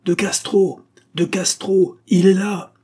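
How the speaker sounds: high, loud urgent shout; crying out a name. Acted. Narrator shouting for De Castro to come see the ghost, loud panicked cry. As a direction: yell his name in terror at the ghost. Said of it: "Je criai son nom".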